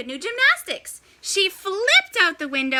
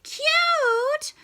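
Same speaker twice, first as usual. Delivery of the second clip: much higher rising cute?; can't believe what she heard; shocked echo; incredulous. Acted. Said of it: "replied Grandma".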